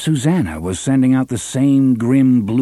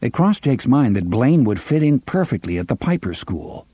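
plain reading voice

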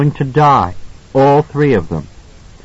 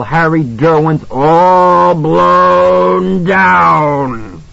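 put-on drunken, violent and despairing; 3 semitones higher than usual. A narrator's reading. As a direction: rage and despair, slurring and laughing. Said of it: "His voice was both violent and despairing".

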